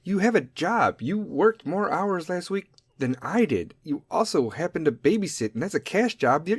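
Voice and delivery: usual speaking voice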